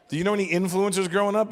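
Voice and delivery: ordinary talk, nothing marked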